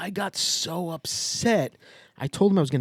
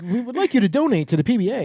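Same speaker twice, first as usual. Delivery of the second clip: quick, higher chirpy donation ask; mock robocall pitch; 6 semitones higher, faster than usual. Imitating the donation robocall to a friend, quick and chirpy. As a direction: rattle off the recorded request. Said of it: "it's like".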